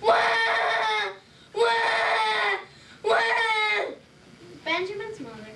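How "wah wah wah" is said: loud high mock baby cries; hammed-up infant wail. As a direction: bawl like a baby as loud as ever. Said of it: "crying as loud as ever".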